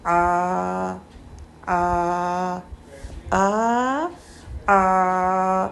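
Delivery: आ drawn from mid-throat, repeated; ain sound. Shown as a demonstration. Teacher contrasting ain with hamza. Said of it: "और आइन की".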